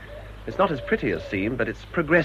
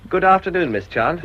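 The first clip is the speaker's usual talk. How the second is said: bright, higher and louder politeness; forced cheery greeting; 8 semitones higher, 7 dB louder than usual. Acted. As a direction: put on a hearty public smile.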